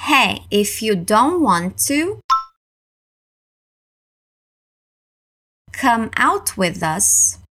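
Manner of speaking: slowed down, clear teaching pace; slow, deliberate practice read. Shown as a demonstration. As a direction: say it slowly so learners can follow. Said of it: "and then all at once".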